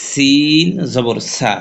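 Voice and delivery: সা held long and slow; slow drill with a drawn-out সা; drawn-out vowel. Shown as a demonstration. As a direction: stretch the vowel in সা. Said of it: "মদের হরফ".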